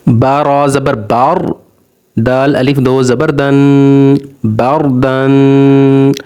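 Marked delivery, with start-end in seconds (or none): 4.5-6.1 बार दन with a heavy, full रा, very slow. Shown as a demonstration. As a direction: draw out बार with a thick रा. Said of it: "यहाँ पर रा मोटा होगा". (बार दन में रा मोटा, धीरे-धीरे)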